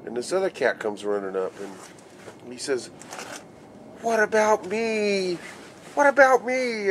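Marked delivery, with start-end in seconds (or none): 4.0-6.9 much higher, pleading voice rising on the repeat; eager squeaky plea. Retold or imitated. Other superintendent running up wanting his turn, squeaky and eager. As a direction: beg to be included like an eager kid. Second boss runs up eager.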